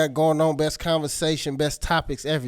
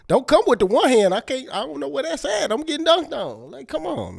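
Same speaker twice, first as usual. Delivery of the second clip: exasperated complaint; 10 semitones higher, 8 dB louder than usual. Real, not acted.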